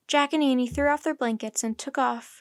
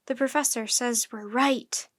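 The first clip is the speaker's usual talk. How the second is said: ordinary delivery, nothing marked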